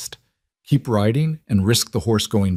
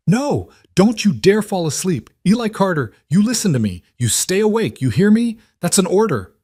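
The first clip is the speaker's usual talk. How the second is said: desperate command; 11 semitones higher than usual. Acted. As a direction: shout him awake in panic. Father ordering son awake.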